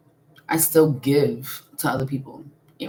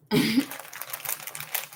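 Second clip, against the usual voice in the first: breathy cackle; 7 semitones higher, 7 dB quieter than usual. Real, not acted. Cackle after joke.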